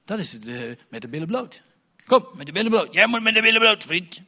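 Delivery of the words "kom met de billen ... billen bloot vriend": pushy demand. Acted. Preacher acts pushy church confessor.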